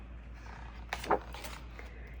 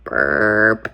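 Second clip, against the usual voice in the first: deep, low belch; big burp. Acted. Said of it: "Hare burps big burps".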